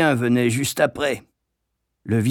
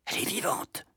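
hushed, higher-pitched whisper to a friend; 8 semitones higher, 11 dB quieter than usual. A narrator's reading. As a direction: say it very softly, in secret. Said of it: "chuchotai-je à Thwaites". (chuchoté, tout bas)

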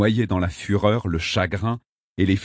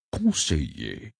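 slow, soft respectful address; low-key deference; gentle, respectful; 3 semitones lower, 4 dB quieter, much slower than usual. A narrator's reading. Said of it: "d'un ton doux, plein de respect".

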